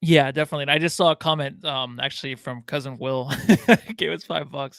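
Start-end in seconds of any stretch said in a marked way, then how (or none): 3.4-3.9 loud, high burst of laughter; real laugh breaking into the talk. Real, not acted. Co-host cracking up over a viewer's five-dollar comment, laughing. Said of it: "laughing a little bit".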